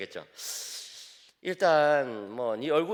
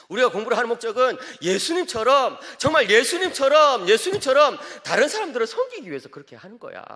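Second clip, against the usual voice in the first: rising fervor; 8 semitones higher, 8 dB louder than usual. Retold or imitated. Father's advice builds to fervor.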